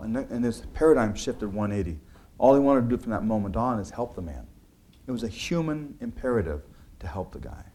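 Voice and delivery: ordinary talk, nothing marked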